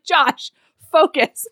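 mock scolding girlfriend; exasperated scold. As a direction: snap him back on task. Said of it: "she's like".

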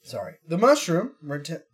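usual speaking voice

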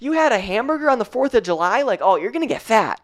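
high-pitched mock outrage at a client; mock scolding. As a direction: pretend to nag her, pitch jumped way up. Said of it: "I'm not going to message you and be like".

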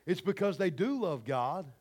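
"do" leaned on in contrast; emphatic "do", correcting a wrong idea; stress on do. Real, not acted. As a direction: put the weight on "do". Contrasts do with don't.

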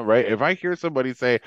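normal talking voice

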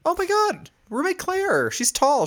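very high, delighted squeal of recognition; real excited recognition. Real, not acted. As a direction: burst out high-pitched, thrilled to hear of her. Delighted at news of roommate.

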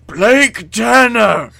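hammed-up high, very loud shout, drawn out; crying out. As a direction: bellow the name slowly, way over the top. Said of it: "a familiar voice cries".

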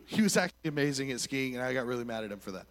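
ordinary delivery

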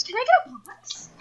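high, polite customer voice. Retold or imitated. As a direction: pitch it up like a timid diner.

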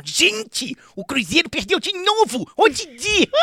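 mock the rival club in a squeaky comic voice; Zacarias voice. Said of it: "Peguei e falei assim, ó". (imitando o Zacarias para zoar um cruzeirense)

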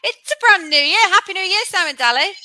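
bright host welcome; podcast intro. Acted.

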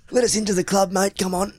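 high wheedling hustler voice; drug dealer voice. Shown as a demonstration. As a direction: coax your way into the club. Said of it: "I've heard you use that voice".

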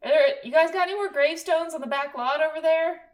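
panicked filmmaker shouting for a gravestone; mock frantic crew call; frantic prop guy.

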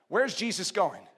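ordinary delivery

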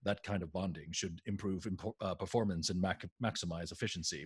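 low, soft, matter-of-fact killjoy; understated low-key drone; party pooper Tuvok. Retold or imitated. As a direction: recite it quietly like a dry party pooper. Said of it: "Party Pooper Tuvok is like".

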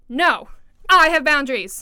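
loud, emphatic shout; acting out a rude, over-loud no; shouted refusal. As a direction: shout it as a firm boundary. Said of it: "I just shouted in your ear".

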